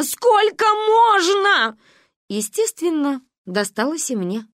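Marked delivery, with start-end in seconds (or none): 0.0-1.8 very high, loud, slow wail; lamenting. A narrator's reading. Mother despairing at her daughters, lamenting. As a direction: wail it higher, dragging every syllable. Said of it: "причитала мама".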